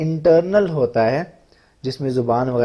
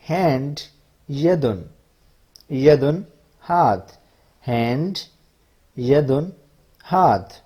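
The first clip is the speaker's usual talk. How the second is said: slow, clear word-by-word recitation; vocabulary drill; slower than usual. Shown as a demonstration. Teacher reciting body words to students, slow and clear. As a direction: spell each word out for the class.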